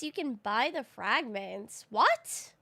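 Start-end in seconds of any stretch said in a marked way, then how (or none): 1.9-2.5 high, loud yelp of disbelief; surprised. Real, not acted. Streamer learns fragments can be bought, surprised. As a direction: blurt it out, pitch jumping up.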